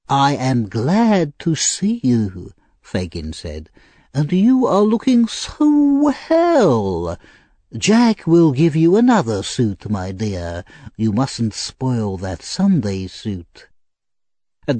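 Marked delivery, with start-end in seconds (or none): 0.1-2.5 slow, drawn-out mock welcome. 4.1-13.7 put-on stretched, swooping old rogue.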